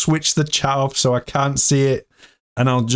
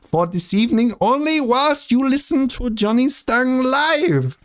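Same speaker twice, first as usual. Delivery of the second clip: ordinary talk, nothing marked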